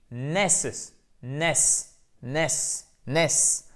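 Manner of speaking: NESS repeated alone as the stressed syllable; stressed syllable drill. Shown as a demonstration. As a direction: drill "ness" on its own, evenly spaced. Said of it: "la syllabe va être porteuse de l'accent tonique". (syllabe accentuée « ness » répétée isolément)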